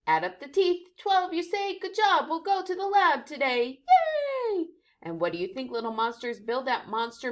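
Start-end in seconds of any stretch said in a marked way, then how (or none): 0.1-4.7 much higher, loud excited; cheerful teacher. Acted. Teacher praising pupils, bright and excited. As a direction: cheer them on.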